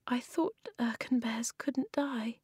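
small, breathy whisper. A narrator's reading. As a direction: whisper it, stunned and grieving. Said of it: "in a small voice".

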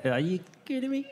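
high, breathy mock disbelief; lightly voiced mate in happy disbelief; imitating Andy. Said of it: "Andy loved that saying".

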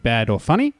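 ordinary talk

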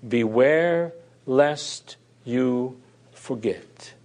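BEWARE drawn out, each word slow and weighty; slow solemn repeat. Shown as a demonstration. Preacher repeating God's warning to his congregation, slow and weighty. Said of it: "repeating the warning slowly".

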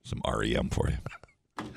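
soft breathy DJ whisper. Pretend. Dedicating a song to listeners, soft whisper. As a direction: hush it like a late-night DJ.

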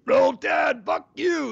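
raspy shouted sign-off. Real, not acted. Coach signing off to a TV host, shouting. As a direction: bark the curse out at the rival.

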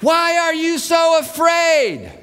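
slow, high shout over a storm; drawn-out high yell; yelled question. Acted. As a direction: holler the question, stretching every word. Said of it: "He's having to yell this".

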